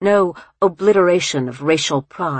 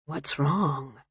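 gentle murmur; 7 dB quieter than usual. A narrator's reading. Groom leaning close to his wife-to-be, murmuring. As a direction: ask it softly, close by. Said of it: "he murmured, leaning close".

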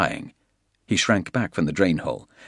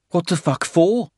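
incredulous; 10 semitones higher, 3 dB louder than usual. Acted. Tully's incredulous question.

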